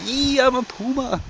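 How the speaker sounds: high, silly put-on puma voice. Storyteller imagining a puma behind the rack, high and silly. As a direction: ham up a talking puma.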